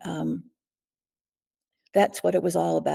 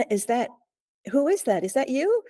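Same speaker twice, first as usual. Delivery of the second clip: calling out to her husband, startled and higher; retold calling out; 7 semitones higher than usual. Woman calling to a running intruder she thinks is her husband, high alarmed call. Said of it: "I yelled out".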